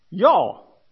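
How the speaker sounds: "y'all" said as a drawn-out Southern "yaw"; Texan y'all. Shown as a demonstration. Pastor joking to Texans, Southern "y'all". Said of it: "we should say y'all".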